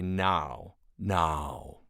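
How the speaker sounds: "now" in a lightly British stage accent; Shakespearean now. Shown as a demonstration. Voice actor showing how Loki says "now", lightly British. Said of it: "The accent comes out in particular with certain words".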